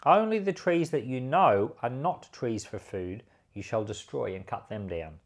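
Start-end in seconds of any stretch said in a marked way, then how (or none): none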